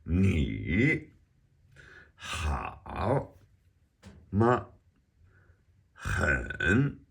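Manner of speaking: Mandarin words sung out one by one, "Ta" high and level, "Ni" dipping then swooping up; exaggerated tones. Shown as a demonstration. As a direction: sing each tone big, like "Hao" swooping and "Dou" held high. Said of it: "Let's sing 'em out and exaggerate 'em".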